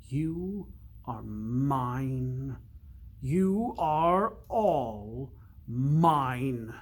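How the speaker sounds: slow, stretched, cold evil voice; cold, ill voice. Acted. The enchanter claiming Littlechild, slow and cold. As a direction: drag each word out like a creeping curse. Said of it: "cold, ill".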